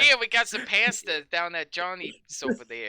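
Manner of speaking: a put-on Italian-American accent, loud and brash; mock Italian-American. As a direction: play a neighborhood Italian guy pitching Johnny's. Mocking Italian-American cliché.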